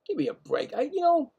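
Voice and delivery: muttered disgust. Real, not acted. Foul ball disappointment.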